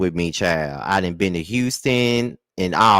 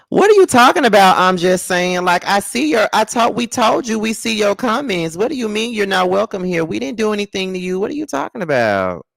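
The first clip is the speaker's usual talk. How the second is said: high-pitched, indignant bewilderment; incredulous protest; 12 semitones higher, 4 dB louder than usual. Real, not acted. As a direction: burst out high in disbelief. Protesting a viewer's complaint.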